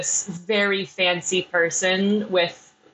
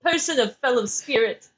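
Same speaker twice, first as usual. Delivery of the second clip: high, genteel and overly polite; posh townsfolk voice; 4 semitones higher than usual. Acted. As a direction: play a prim fellow citizen. In-character exchange, posh voice.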